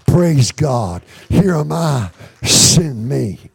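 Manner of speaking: normal talking voice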